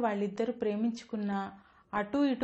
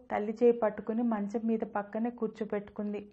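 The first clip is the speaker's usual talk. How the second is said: usual speaking voice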